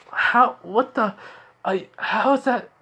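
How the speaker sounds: high-pitched, halting disbelief; bewildered. Retold or imitated. Girl watching boyfriend collapse, bewildered. Girl baffled by what happened.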